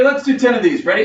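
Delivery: ordinary delivery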